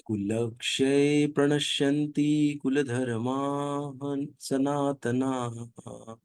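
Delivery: slow, measured Sanskrit chanting; verse recitation. Acted. Teacher chanting a Gita verse to the class, slow and measured. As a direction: draw each syllable out like a sacred verse.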